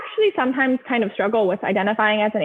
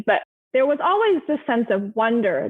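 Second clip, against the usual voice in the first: ordinary talk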